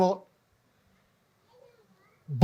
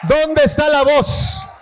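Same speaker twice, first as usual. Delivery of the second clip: louder and slower each time; preacher calling out louder and slower; rising call; 4 semitones higher, 6 dB louder, slower than usual. Real, not acted. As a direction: press the crowd harder on every repeat.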